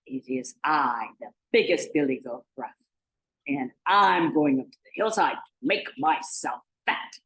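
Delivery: low, gruff goat voice; big gruff billy goat. Acted. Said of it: "in his own big gruff voice".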